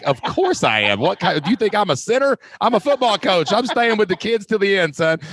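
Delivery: playfully outraged, hamming up the coach; mock indignant coach. Coach answers the principal in hammed-up mock outrage.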